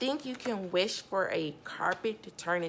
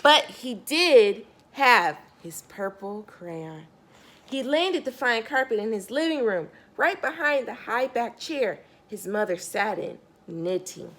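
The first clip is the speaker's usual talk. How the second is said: put-on lively storybook narration for kids; storyteller reading Harold to a young listener; reading to children; 2 semitones higher, 5 dB louder than usual. A narrator's reading. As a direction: read it out like bedtime story time to a child. Reads picture book aloud.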